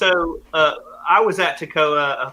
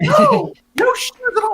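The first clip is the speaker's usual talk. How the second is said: put-on much higher, shocked old lady's voice; over-the-top older lady. The older lady insists to the guys, high and shocked. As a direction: squeal it like a scandalized lady. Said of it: "And she's like".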